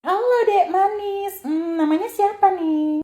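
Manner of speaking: high, slow, sugary sweet-talk; overly friendly. Acted. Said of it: "Dih, sok ngakrab". (pramugari sok akrab menyapa anak kecil dengan suara manis yang dibuat-buat)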